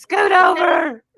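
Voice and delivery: high, loud, slow sing-song scoot over; mock whiny plea to make room; playful order. Mother asking toddler for room in bed, high playful.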